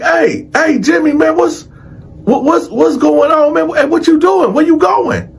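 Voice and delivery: high, agitated string of questions; urgent calling out. Retold or imitated. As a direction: call after someone walking away, demanding answers. Inmate calling after departing Jimmy.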